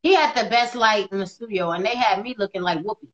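usual speaking voice

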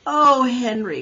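loud dismayed wail. A narrator's reading. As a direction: wail it in despair. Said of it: "wailed Miss Roop".

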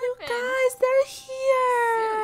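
high, soft, tearful-sounding squeak; overwhelmed cooing. Real, not acted. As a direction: squeak it tenderly, overcome by the babies. Moved by the newborns.

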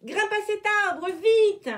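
urgent shouted order from the treetop; commanding shout. A narrator's reading. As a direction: shout down from above. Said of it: "ordonna une voix tombée du ciel".

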